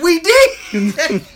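high, loud retold protest; helpless protest. Retelling friend's helpless protest.

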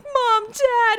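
high, loud hammed-up greeting; mock excitement. Vlogger mimicking Peter as his parents show up, mock excited. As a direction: squeal it in pretend delight. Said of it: "he's, like, so excited to see them".

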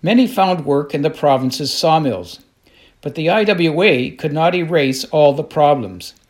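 normal talking voice

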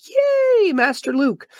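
squealed high fangirl cheer; mock cheer. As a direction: squeal it like a thrilled fan. Cheering for Luke mid-blurb.

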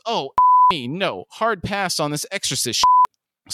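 acted yell rising in pitch and slowing; yelled refusal. A narrator's reading. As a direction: build to a high, emphatic refusal. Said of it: "Huey yells".

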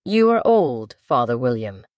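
recited verse, measured and sing-song; poem recitation. Acted. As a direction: recite it like a schoolchild. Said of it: "Alice folded her hands and began".

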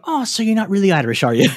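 teasing put-on Irish accent; Irish girls. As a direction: mimic sceptical Irish girls. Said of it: "And they go".

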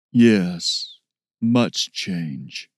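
slow, low, halting ape-man. Acted. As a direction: speak slowly like a gentle beast.

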